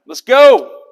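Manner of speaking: loud, high battle shout; rallying shout. Acted. Army coming off the hill to fight, rallying shout. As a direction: yell it like the charge is starting. Acted soldiers charging off the hill.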